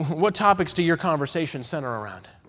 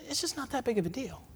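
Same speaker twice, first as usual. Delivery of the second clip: soft excuse; 6 semitones higher, 12 dB quieter than usual. Pretend. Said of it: "There's excuse number one".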